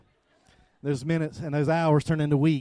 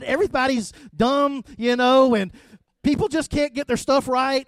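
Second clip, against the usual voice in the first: grumpy; 7 semitones higher, 8 dB louder than usual. Retold or imitated. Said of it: "I'm irritated".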